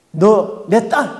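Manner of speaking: the demon sneering at her; demon cursing. Retold or imitated. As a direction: spit it at the mother. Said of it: "욕을 하는데요".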